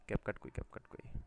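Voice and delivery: slow whispered muttering to himself; whispering to himself. Real, not acted. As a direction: whisper it slowly while hunting for the app. Searching the screen, muttering to himself.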